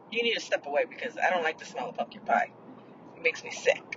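quiet and half-whispered, trailing into breathy whisper; lowering the voice to tell her to step away; hushed retold telling-off. Hushed telling-off to a stranger about her smell, whispered at the end.

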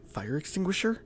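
"Fire extinguisher?" high, soft and disbelieving; incredulous echo. A narrator's reading. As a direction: echo it back, unable to believe it. Leah echoes in disbelief.

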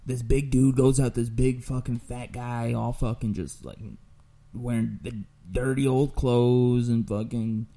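normal talking voice

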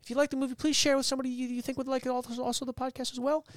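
lightly voiced channel sign-off; outro voice. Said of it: "I was very soft-spoken".

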